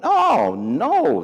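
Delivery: high, exclaimed oh no; hammed-up astonished protest; mock disbelief. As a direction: react with playful, exaggerated dismay. Acts disbelief at her lack of excitement.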